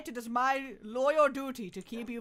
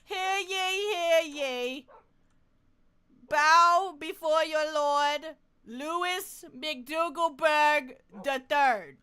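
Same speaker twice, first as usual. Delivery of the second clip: loud, higher, slow town crier call; herald proclamation; 7 semitones higher, 10 dB louder, much slower than usual. Pretend. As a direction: announce the lord like a royal herald. Herald announcing again.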